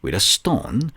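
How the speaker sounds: ordinary delivery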